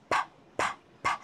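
breathy puff of air on each p, unvoiced; aspirated p puffs. Shown as a demonstration. Teacher demonstrating the p sound, puffs of air. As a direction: push air out hard on every p. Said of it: "you have to feel the air".

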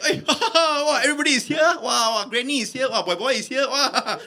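high, jolly laughing greeting; hammed-up cheerful amazement; all happy. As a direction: gush as if delighted to see everyone. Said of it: "he came out all happy".

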